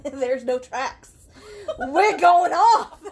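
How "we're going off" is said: slow laughing exclamation; cracking up as you shout it; laughing, louder. Real, not acted. Laughing joke outburst.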